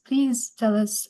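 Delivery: ordinary talk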